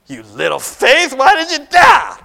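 loud, high mock rebuke; harsh scolding. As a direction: bellow it as an exaggerated telling-off. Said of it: "He didn't say it like that".

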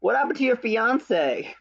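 high, slow incredulous whine; hammed-up outrage at the screen; mock disbelief. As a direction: act baffled at the missing fiance. Mocking own reaction to the kiss.

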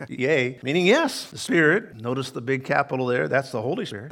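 ordinary delivery

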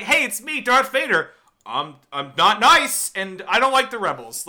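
lightly voiced cheery Vader, loud and slowing; a goofy Darth Vader introducing himself; mock Darth Vader. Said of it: "Darth Vader come out and be like".

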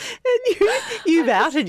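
laughing. Real, not acted. Cracking up while telling.

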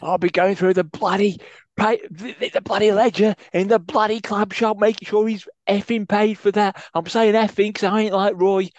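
high, loud put-on manager voice; Betis impression. Co-host doing Betis ranting about the club shop. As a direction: rant in character, ranting and fuming. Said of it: "That went a bit steptoe at the end".

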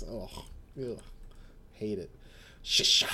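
normal talking voice

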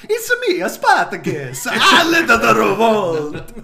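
high, slow mock Italian Mario accent. Joking about Roman movies, Mario-style Italian accent. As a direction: ham up a cartoon Italian Spartacus. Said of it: "done with Mario accents".

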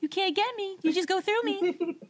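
high-pitched playful taunt; singsong teasing in a put-on voice; teasing ghost. Ghost dares a dog to catch it, teasing voice.

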